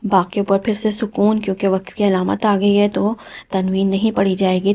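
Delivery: normal talking voice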